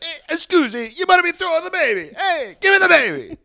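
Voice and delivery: very high, loud hammed-up Italian, fast; Italian accent. Relatives demanding the baby, hammed-up Italian accent. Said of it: "they definitely are like".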